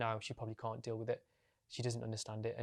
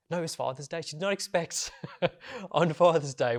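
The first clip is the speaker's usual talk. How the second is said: breaking into a laugh mid-sentence, higher; 5 semitones higher, 9 dB louder than usual. Retold or imitated. Telling a viewer how mum got outed at dinner, laughing. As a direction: laugh through the correction, amused at the memory. Laughs while correcting the day.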